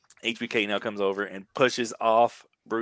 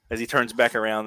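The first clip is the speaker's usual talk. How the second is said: normal talking voice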